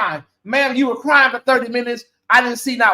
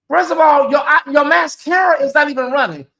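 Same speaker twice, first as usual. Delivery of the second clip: higher, louder indignant callout; 6 semitones higher, 3 dB louder than usual. Real, not acted. Calling out the widow to her viewers, loud and indignant. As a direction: call her out, outraged and sure.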